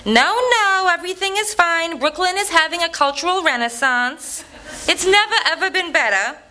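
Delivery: high-pitched doting aunt, chuckling at the end; Aunt Mir impression. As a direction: pitch way up into a fussy elderly aunt insisting all is fine. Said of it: "Auntie Mir would go".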